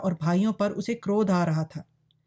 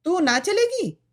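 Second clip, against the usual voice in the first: much higher, scolding demand; harsh old woman; 10 semitones higher, 4 dB louder than usual. A narrator's reading. Old mother demands that daughter come, harsh. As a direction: demand it harshly, pitched right up. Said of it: "बुढ़िया कर्कश स्वर में बोली".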